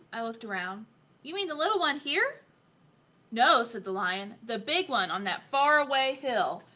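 put-on lively, expressive story reading for a young child; picture book reading. A narrator's reading. Adult reading Lion Lessons to a child, animated storytelling. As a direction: read it aloud with playful emphasis.

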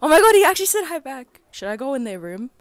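normal talking voice

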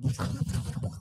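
quick burst of mouth-drum noises; imitated fancy beatboxing. Shown as a demonstration. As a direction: dash off a fussy beatbox fill. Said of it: "I don't like doing all".

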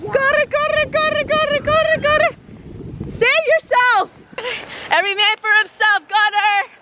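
high frantic calling; hammed-up run for your life; mock panic.

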